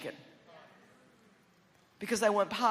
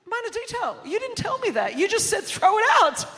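high, indignant protest, building louder; defensive comeback; 10 semitones higher, 10 dB louder than usual. Retold or imitated. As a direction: protest loudly, as if wrongly blamed. Retold comeback in quarrel with husband.

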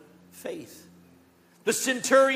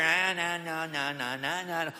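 singsong scornful nah nah; mocking jeer; 4 semitones lower than usual. Religious people scorning Jesus, singsong mockery. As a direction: taunt like a scoffing crowd. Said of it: "they mock him".

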